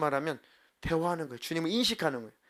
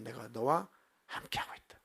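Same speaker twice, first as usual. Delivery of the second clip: whispered, low and hushed; God's whispered answer; 3 semitones lower, 6 dB quieter than usual. Acted. Pastor voices God answering the doubting believer, whispered. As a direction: breathe the words like a voice from within.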